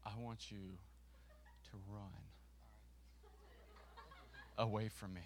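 very slow, low and soft; feed the instruction quietly, word by word; hushed instruction. Real, not acted. Speaker coaching a volunteer for the skit, slow and hushed.

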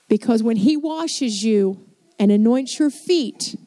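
usual speaking voice

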